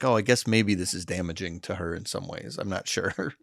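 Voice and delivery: quiet self-quoted second thoughts; doubtful musing. Retold or imitated. Dad voices his own worry about his daughter, doubtful musing.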